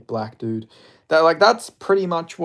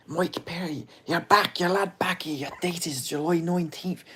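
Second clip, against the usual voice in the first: Irish accent, higher, imitating Conor; Conor McGregor impression; 4 semitones higher, 3 dB quieter than usual. Streamer quoting Conor taunting Mike Perry, in an Irish accent. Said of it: "that's what he said to Mike Perry".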